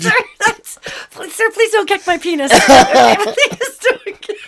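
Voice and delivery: high, squeaky mock plea through laughter; laughing plea. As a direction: beg while cracking up. Acted plea, breaking up laughing.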